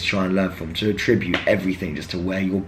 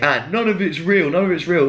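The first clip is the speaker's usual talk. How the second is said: mock nagging critic; 8 semitones higher than usual.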